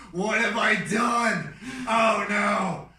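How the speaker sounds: loud, high, drawn-out cry; mock panicked wail. Brother reliving the moment after the crash, mock despairing shout. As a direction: wail it slowly, hammed-up despair.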